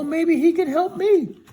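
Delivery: high, loud cartoon scarecrow; storyteller voicing a high silly scarecrow. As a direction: pitch way up and call out as the scarecrow. Scarecrow talks to Dorothy.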